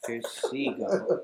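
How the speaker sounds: teasing. Real, not acted. Cohost teasing drama.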